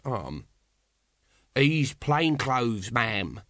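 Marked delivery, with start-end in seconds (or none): 1.5-3.4 smartly reporting, drawn-out words; smart reply. A narrator's reading. Nobby reporting to a lady, smartly. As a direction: report it smartly, like a constable on parade. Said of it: "said Nobby smartly".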